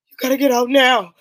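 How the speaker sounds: mock pissed-off order. Doctor ordering the hiding girls out, angry. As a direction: bark it like a furious man losing patience. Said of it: "he's all pissed off".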